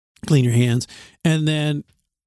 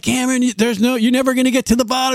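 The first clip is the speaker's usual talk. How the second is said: higher, fast pleading; urgent dad; 8 semitones higher, faster than usual. Retold or imitated. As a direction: hurry the boy along, exasperated. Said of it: "I'm like".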